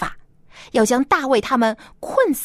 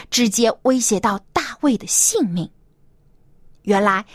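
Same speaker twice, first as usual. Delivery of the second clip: ordinary delivery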